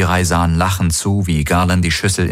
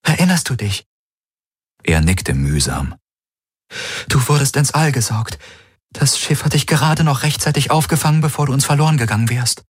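put-on raised higher, gentle young woman; Kirai's voice; 7 semitones higher than usual. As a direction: speak as Kirai, caring for the wounded.

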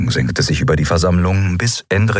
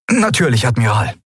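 higher-pitched, prompt acknowledgement; dutiful officer; 5 semitones higher than usual. A narrator's reading. First officer acknowledging the admiral's order, dutiful. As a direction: answer promptly, voice falling.

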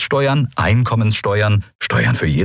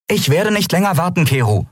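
much higher, adamant voice; firm insistence; 11 semitones higher than usual. A narrator's reading. Captain Andriel setting the engineer straight, firm and insistent. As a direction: lay it down firmly, pitched high. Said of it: "stellte Andriel klar".

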